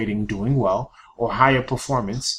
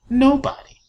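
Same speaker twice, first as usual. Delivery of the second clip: emphatic nobody; 14 semitones higher, 6 dB louder than usual. Real, not acted. Rhetorical answer, sharp pitch jump.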